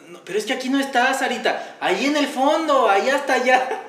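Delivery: high, loud sly coaxing; mocking scheming voice. Acting out Sarita's trick. (voz alta y taimada)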